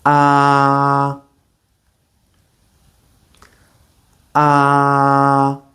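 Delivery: "Ah" with mouth wide open, relaxed; Mandarin a vowel. Shown as a demonstration. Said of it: "watch my mouth and repeat after me".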